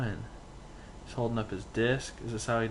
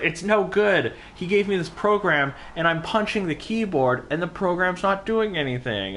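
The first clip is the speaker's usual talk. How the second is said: imitating Leon; 9 semitones higher, 3 dB louder than usual. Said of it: "he was like".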